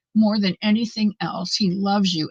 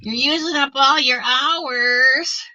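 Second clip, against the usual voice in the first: StreamYard nagging; 5 semitones higher, slower than usual. Retold or imitated. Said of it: "StreamYard's gonna be knocking on my door saying".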